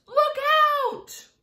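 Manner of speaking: high, loud warning yell; cried warning. A narrator's reading. James sees the centipede near the edge, crying out. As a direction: yell it urgently, high-pitched. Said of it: "cried James".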